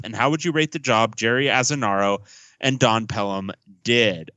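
ordinary talk

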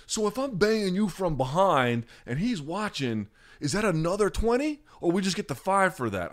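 high put-on voice, working out a price; hammed-up high-pitched haggler; haggling prostitute. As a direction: mimic a streetwalker matter-of-factly negotiating. Acts out prostitute negotiating a price.

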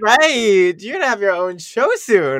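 loud, high and thrilled; adrenaline rush. Real, not acted. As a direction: burst out with excitement. Excited reaction to the deep remark.

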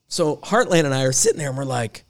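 ordinary talk

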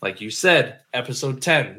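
ordinary talk, nothing marked

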